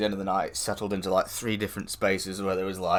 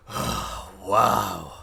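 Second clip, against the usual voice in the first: imitating a leering guy; 3 semitones lower than usual. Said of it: "this guy next to me just goes".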